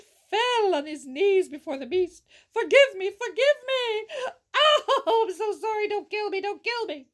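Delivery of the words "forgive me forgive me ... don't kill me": loud, higher, hammed-up pleading with a laughing cry; begging in fear. Merchant on his knees begging the beast, hammed-up fearful pleading. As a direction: beg for your life, overacted. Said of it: "Trembling with fear".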